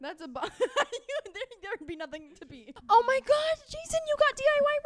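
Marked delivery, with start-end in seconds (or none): 2.9-4.8 high excited squeal. Real, not acted. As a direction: squeal with delight. Excited about friend's recipes.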